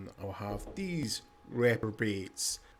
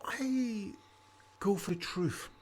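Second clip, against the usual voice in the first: high, welcoming innkeeper voice, pitched way up; tavern keeper; 8 semitones higher than usual. Acted. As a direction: greet weary travelers like a chatty host.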